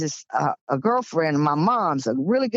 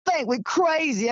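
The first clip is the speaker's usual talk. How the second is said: mildly tearful, wounded protest; retold tearful complaint; 8 semitones higher than usual. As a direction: imitate an upset man pouring out his hurt, pitched up. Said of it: "He just started crying".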